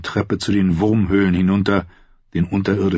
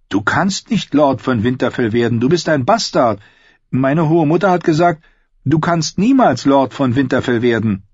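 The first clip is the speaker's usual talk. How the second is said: put-on higher, indignant boy's voice; childish retort; 6 semitones higher than usual. A narrator's reading. Young Robb rebuffing his bastard brother, indignant retort. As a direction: put him in his place, pitched up. Boy rejects bastard's claim in game.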